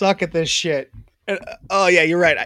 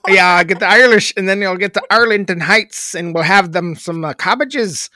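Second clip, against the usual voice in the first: Chicago accent sliding into mock Irish; hammed-up Chicago-Irish lilt; Chicago turning Irish; 3 dB louder than usual. Shown as a demonstration. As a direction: let a Chicago voice drift Irish.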